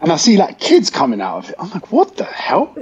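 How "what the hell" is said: high-pitched incredulous exclamation; retold disbelief. Telling friend about kids leaving the escorted bus, high disbelief. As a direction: act out being baffled, voice jumping up.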